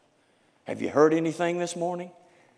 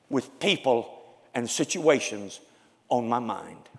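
usual speaking voice

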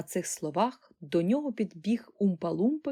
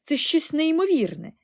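stunned exclamation; 9 semitones higher, 7 dB louder than usual. A narrator's reading. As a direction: cry out the number, staggered. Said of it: "вигукнув ошелешений пан Бакет".

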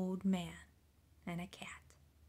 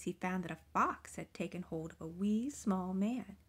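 plain reading voice

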